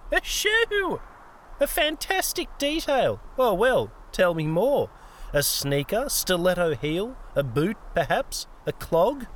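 high, gleeful mockery falling into teasing questions; mocking. A narrator's reading. Old woman ridicules Peter's choice of weapon, mocking. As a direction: squeal in mock amazement, then tease with guesses. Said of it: "tone had again turned to mocking".

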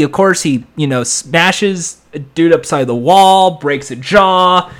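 ordinary talk, nothing marked

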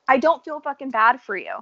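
normal talking voice

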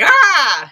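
sudden loud high "Ah!" yelp; battle cry noise. Retold or imitated. James charging Bitters in bandana gear, loud high yelp. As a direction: burst out with a startling high yell. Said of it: "James makes this noise".

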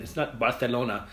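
Barcelona with a lisped c, Bar-the-lona; Barcelona with a Spanish th sound. Shown as a demonstration. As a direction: say Barcelona the Castilian way, c as th. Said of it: "I like saying it".

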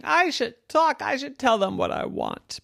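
put-on lifted child's voice, decided; little girl resolving. A narrator's reading. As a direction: talk yourself into it like a small girl. Yvette's inner voice resolving.

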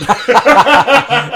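big high laugh, loud; loud burst of laughter. Real, not acted. Cohost cracking up loudly at the fake guest joke. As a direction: let a huge laugh burst out.

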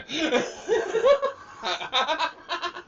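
high burst of laughter; laughing. Real, not acted. Friend laughing at the plate joke.

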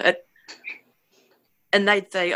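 ordinary delivery, nothing marked

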